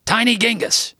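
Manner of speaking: high shouted bark; barked call. A narrator's reading. Gramps summoning his grandson, high barked shout. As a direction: bark the nickname out. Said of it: "barked loudly".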